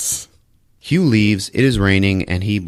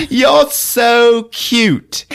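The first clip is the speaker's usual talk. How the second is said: much higher, louder, very slow seductive coo; vampy coo; 6 dB louder, much slower than usual.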